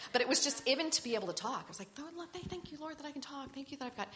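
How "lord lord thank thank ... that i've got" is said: very high, softer, hurried little prayer; gushing thanks. Retold or imitated. Wife thanking God she can talk, high and gushing. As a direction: squeak out relieved thanks, imitating yourself. Retold grateful prayer in bed.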